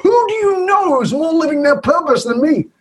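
high outraged disbelief, voice shooting up; mad. Retold or imitated. To his wife over her score, high indignant outrage. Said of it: "I'm mad".

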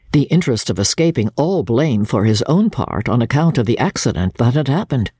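ordinary delivery

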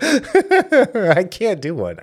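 high, loud laughing admission; real laughter breaking into the words. Real, not acted. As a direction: crack up at your own bad attempt. Laughing at own failed accent.

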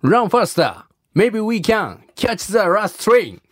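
careful learner's English with a Japanese accent. Shown as a demonstration. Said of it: "発音をちょっとしてもらおう".

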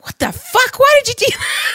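high-pitched squeal of pretend outrage; hammed-up, incredulous high voice; mock appalled disbelief. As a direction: react like a scandalised hacker at a party. Acted partygoers react in disbelief.

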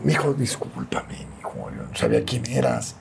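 soft, imitated plea for pardon; retold contrite apology. Wrestler apologising to the Santo's son, softly contrite. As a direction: apologise quietly, drawing out the first words.

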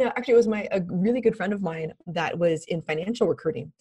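ordinary delivery

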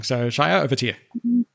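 usual speaking voice

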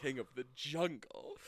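a hint of groovy spy swagger; lightly voiced Austin Powers. Playing Austin Powers for his friend, lightly voiced. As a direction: lean into baby, easy and smug. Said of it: "got a little Austin Powers to it".

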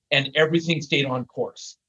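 normal talking voice

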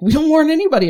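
high, loud mock protest; mock retort. Coworkers' retort acted out.

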